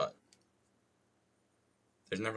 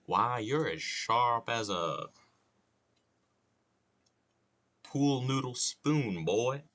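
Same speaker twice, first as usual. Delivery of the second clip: slow Southern cartoon drawl, groping for the punchline; halting, drawn-out Southern bluster; Foghorn Leghorn; 4 semitones higher, 3 dB louder, slower than usual. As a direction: improvise a drawling Southern rooster insult. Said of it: "doing that impression".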